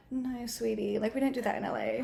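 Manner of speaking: soft, patronizing imitation of a stranger; condescending. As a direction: quietly put them down, mock-sweet. Retold condescending rebuke about shoes.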